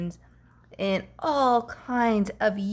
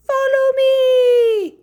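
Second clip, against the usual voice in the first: high, slow, loud cry to the troop; rally the monkeys like their leader; a monkey chief hollering to follow; leader monkey call; 11 dB louder, slower than usual. Acted. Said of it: "The leader monkey calls".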